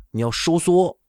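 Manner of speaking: ordinary delivery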